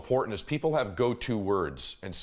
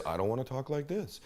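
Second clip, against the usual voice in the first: softer, dropped-down reply; low volume; 6 dB quieter than usual. Shown as a demonstration. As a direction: drop the volume right down. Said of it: "I wasn't listening for their volume level".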